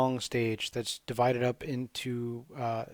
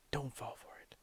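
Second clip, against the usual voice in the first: much softer unvoiced whisper; 15 dB quieter than usual. Gamer secretly warning Nemo, whispered.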